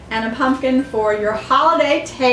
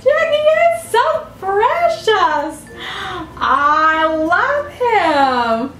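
gushing; 7 semitones higher, much slower than usual. Real, not acted. Praising the gift.

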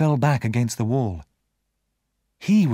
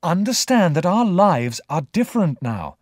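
higher, insistent explanation; firm young Pip; 5 semitones higher, 3 dB louder than usual. A narrator's reading. Pip tells the convict their lives differ, firm. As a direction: spell it out firmly, pitched up.

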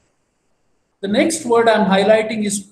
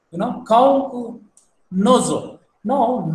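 usual speaking voice

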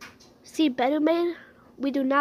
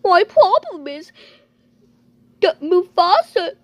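louder, crying report; tearful complaint; 3 semitones higher, 6 dB louder than usual. Pretend. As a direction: sob out the complaint. The owner reports the theft.

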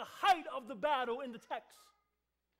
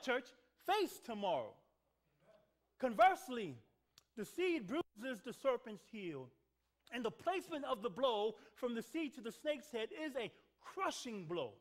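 ordinary talk

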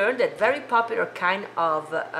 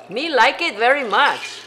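delighted; 5 semitones higher, 8 dB louder than usual. Real, not acted. Delighted with the cocktail.

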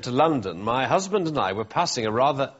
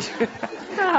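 hysterical laugh; 13 semitones higher than usual. Real, not acted. Reader corpses mid-letter.

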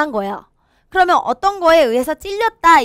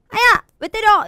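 high, loud protest at being hit; acted ow, indignant; pained yelp; 9 semitones higher, 4 dB louder than usual. Shown as a demonstration. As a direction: yelp as if just smacked. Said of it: "탁 때리면 뭐라 그래요?".

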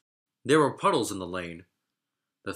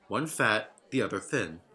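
plain reading voice

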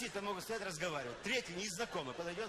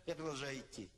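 ordinary talk, nothing marked

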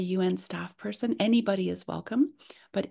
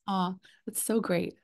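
usual speaking voice

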